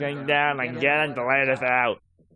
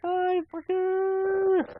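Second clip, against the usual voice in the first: very high, very slow, drawn-out mock villain; creepy slow sing-song taunt; menacing movie-villain quote; much slower than usual. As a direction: stretch it out high like a cartoon killer. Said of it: "if you hear me say a phrase like".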